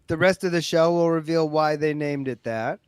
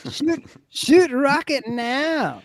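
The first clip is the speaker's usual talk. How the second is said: higher, slow mock North Korean accent; 10 semitones higher, much slower than usual. Imitating Brian's North Korean accent, higher and slow. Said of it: "all Brian's accents sound North Korean".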